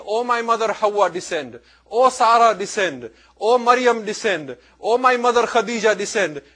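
loud, high calls falling on each "descend"; crying out loudly. Retold or imitated. Lady summoning the mothers from heaven, loud retold crying out. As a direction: imitate a loud, grieving summons. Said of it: "She was crying loudly".

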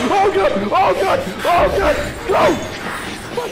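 very high, very loud shrieks; terrified screaming. Real, not acted. Player screaming to get away, terrified. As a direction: shriek in sudden fright, again and again. Sudden scare in game.

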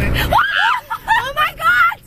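high, drawn-out shout of shock; startled shout. Real, not acted. In the storm, shouting in fright at a close strike. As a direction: cry out as the bolt hits near.

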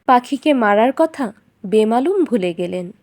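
ordinary delivery

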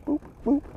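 high playful mock horn beeps, softly. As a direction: beep like a little bike horn. Mimicking a horn on bike.

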